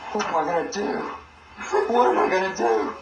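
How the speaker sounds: mock despairing prosecutor. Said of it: "he goes".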